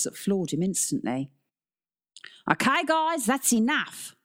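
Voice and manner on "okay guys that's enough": raised, high-pitched Aussie teacher's voice; teacher calling out in an Australian accent; ringing Australian call. A narrator's reading. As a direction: ring out down a hall to break up a scuffle. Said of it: "a voice suddenly rang down the hall".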